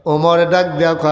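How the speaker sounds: ordinary delivery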